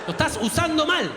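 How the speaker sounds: penis complaining. Acted. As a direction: yell like an outraged body part. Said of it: "el pito está como".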